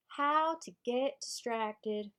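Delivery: drawn-out put-on Southern accent. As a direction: announce a chapter title in a Southern accent. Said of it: "I'm reading this with a Southern accent".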